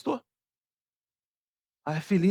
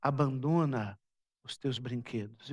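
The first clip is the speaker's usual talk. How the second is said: much softer, lower voice of God; gentle divine voice; 5 semitones lower, 5 dB quieter, slower than usual. Retold or imitated. Pastor voicing God's gentle third call, soft and low. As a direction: let the command fade soft and low. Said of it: "pela terceira voz".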